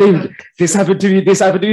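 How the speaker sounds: excited plea. Retold or imitated. Retelling his own excited plea.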